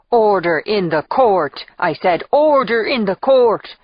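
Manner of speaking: loud, slow mock-judge shout. A narrator's reading. Macklin banging the kitchen table at the party, slow shout. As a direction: boom it out over a noisy room, drawing out the words. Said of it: "bangs on the kitchen table and shouts".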